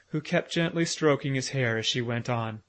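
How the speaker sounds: usual speaking voice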